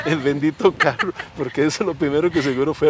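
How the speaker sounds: laughing mockery of the car. Real, not acted. (riendo, burlón)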